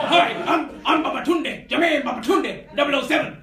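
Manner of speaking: hammed-up spy introducing himself. Comic telling the crowd his Bond would introduce himself, mock suave and loud. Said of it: "They'd have to be called Jermaine Babatunde".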